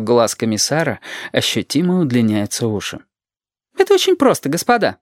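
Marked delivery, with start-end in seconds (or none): 3.8-5.0 high-pitched schoolmistress voice; acted pedantic woman, pitched up; lecturing teacher. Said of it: "Она учитель, а они балбесы-ученики".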